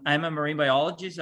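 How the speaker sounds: ordinary talk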